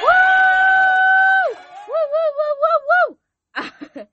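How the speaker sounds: high, loud celebratory woo; joyful whooping with a laugh; excited whoops. Real, not acted. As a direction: cheer it out at the top of your voice. Celebrating a podcast milestone.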